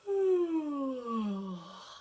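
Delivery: relaxed demonstration yawn sigh. Shown as a demonstration. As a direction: open yawning sigh. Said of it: "the yawn sigh".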